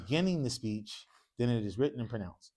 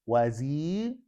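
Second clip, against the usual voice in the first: slow model; 4 dB louder, much slower than usual. Shown as a demonstration. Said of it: "So again".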